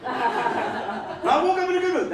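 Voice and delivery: high, slowed-down mock cheer; mock-cheerful morning announcement. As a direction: chirp it high and drawn out. Morning cheer set against dread.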